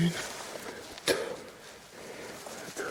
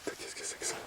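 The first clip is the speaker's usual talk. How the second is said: hushed, breathless whisper; 3 semitones higher, 23 dB quieter than usual. Real, not acted. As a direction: whisper so nothing hears you. Exploring in the dark, frightened.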